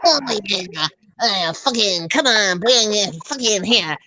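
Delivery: exaggerated Philly twang, swearing away; Philly accent. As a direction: imitate a sweary Philly woman's voice. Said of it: "She talks like that. She's like a Philly".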